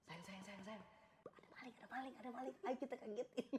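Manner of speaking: high, very soft urgent whispering; urgent hushed voice. Acted. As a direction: wake your partner without alerting the thief. Suami membangunkan istri diam-diam. (berbisik panik)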